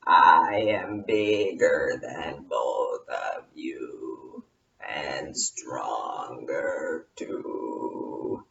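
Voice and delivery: very low, slow, breathy moan; deep mummy groan. Acted.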